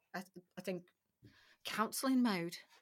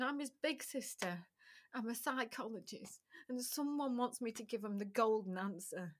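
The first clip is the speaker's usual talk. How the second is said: usual speaking voice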